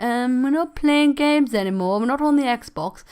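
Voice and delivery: telling yourself off in a slow, lilting voice; mock self-check. Acting out a mental self-check.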